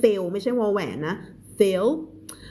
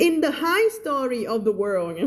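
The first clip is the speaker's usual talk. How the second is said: higher, slow mock reading breaking into laughter; ครูเพนสี; 4 semitones higher, much slower than usual. Teacher can't resist mimicking another teacher, playful high impression. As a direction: draw out "high story" in a raised mimicking voice. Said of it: "อ่านแบบครูเพนสีอ่านว่า".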